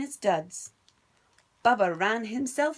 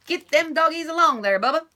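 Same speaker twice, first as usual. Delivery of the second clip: louder, higher bossy stepbrother; bossy order; 8 semitones higher, 8 dB louder than usual. Acted. Stepbrother Dwayne ordering Bubba about, loud and bossy. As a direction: boss him around from horseback. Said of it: "ordered Dwayne".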